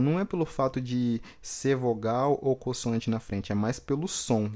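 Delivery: ordinary talk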